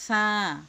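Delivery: সা with tongue tip against the upper front teeth, air pushed out; lisped tha sound. Shown as a demonstration. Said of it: "জিহ্বার আগা সামনের উপরে দুই মোটা দাঁতের সঙ্গে লাগিয়ে". (সা জিহ্বার আগা দাঁতে লাগিয়ে বাতাস বের করে)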